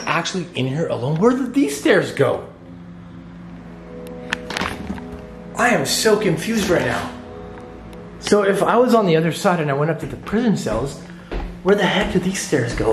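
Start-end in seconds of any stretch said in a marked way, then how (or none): 1.2-12.9 high, tense and worried; rattled, searching aloud; anxious high voice. Real, not acted. Confused and scared upstairs.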